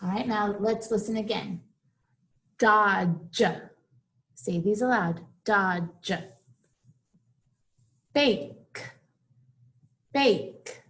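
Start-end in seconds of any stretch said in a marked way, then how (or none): 2.6-3.4 "Dodge" with a separately released j at the end; stressed ending sound. Shown as a demonstration. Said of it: "Listen to those ending sounds". 5.4-6.1 "Dodge" with a separately released j at the end; stressed ending sound. Shown as a demonstration. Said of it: "Listen to those ending sounds". 8.1-10.8 "Bake" with a crisp, separate final k; stressed ending sound. Shown as a demonstration. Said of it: "Listen to those ending sounds".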